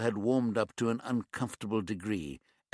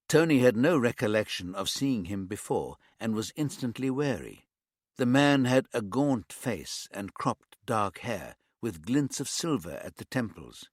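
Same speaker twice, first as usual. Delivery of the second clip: usual speaking voice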